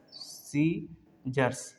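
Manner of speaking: "Seizures" slow and soft, split as सी, जर्स; syllable-by-syllable model. Shown as a demonstration. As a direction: draw out "seizures" softly in two parts. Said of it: "सी का sound नहीं होता है".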